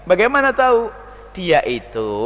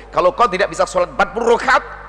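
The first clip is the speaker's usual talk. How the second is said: normal talking voice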